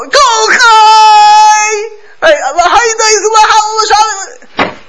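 high, loud ecstatic scream; hammed-up screaming worshipper; screaming prayer. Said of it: "He starts screaming like".

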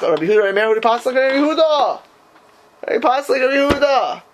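high, emphatic questioning; insistent challenge. Real, not acted. Teacher demanding why the rabbi washed only partly, insistent challenge. Teacher pressing the difficulty on students.

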